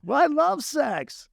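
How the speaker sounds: milk it on stage, slow and high; imitating his own slow stage delivery; slow high mock line. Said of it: "And I said".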